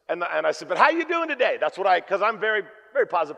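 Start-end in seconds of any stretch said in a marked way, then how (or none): 0.7-1.6 much higher, bright upbeat hello; overly chipper customer hello; cheery greeting. Retold or imitated. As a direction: greet her like the happiest guy alive.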